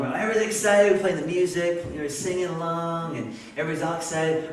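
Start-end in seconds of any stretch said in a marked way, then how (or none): none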